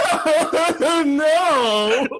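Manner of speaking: high, laughing oh no; fake-sob through laughter; mock dismay. Viewer reacting to sad TV drama, laughing mock dismay. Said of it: "you're just like".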